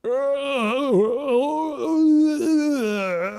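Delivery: a high, wailing burst of laughter. Real, not acted. Streamer cracking up at his own stumble, high wailing laugh. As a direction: laugh helplessly, almost crying.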